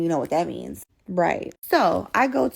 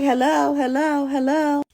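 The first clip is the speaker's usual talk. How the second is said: high, loud, insistent repeats; calling out; 8 semitones higher, 4 dB louder, slower than usual. Retold or imitated. Calling to boyfriend on an open line, insistent call. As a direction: call down the phone for an answer.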